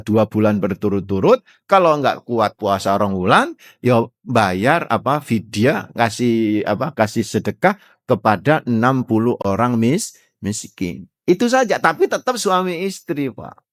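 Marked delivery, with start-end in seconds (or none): none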